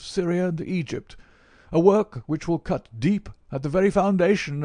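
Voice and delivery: put-on high, loud, declaiming old scholar; emphatic, high-voiced elderly academic; old professor, self-important. A narrator's reading. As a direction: hold forth on a life's work, pitched high.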